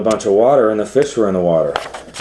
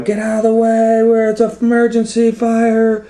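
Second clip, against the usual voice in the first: firemen yelling; 3 dB louder than usual. Acted. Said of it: "they were going".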